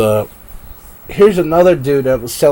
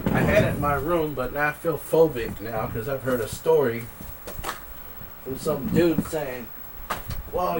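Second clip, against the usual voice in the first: quiet; 2 semitones higher, 16 dB quieter than usual. Real, not acted. Mom's asleep.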